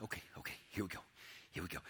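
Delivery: breathless whisper to yourself; whispered self-talk. Retold or imitated. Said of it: "that inner dialogue starts coming out".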